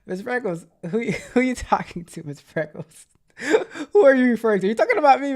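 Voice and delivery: high laughing mock outrage. Real, not acted. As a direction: laugh while asking who he means. Laughing reply to chatter.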